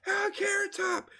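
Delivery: very high-pitched fans calling out; high falsetto crowd cheer; excited crowd. Acted. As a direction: imitate fans hollering a celebrity's name. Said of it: "everybody in the crowd".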